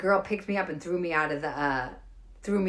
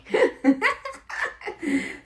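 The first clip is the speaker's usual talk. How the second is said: high giggling laugh; amused laugh; 11 semitones higher, 4 dB louder than usual. Real, not acted. Comic after her steak punchline, giggling laugh. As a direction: crack up at your own story.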